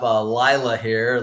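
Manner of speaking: ordinary delivery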